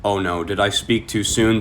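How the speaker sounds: ordinary talk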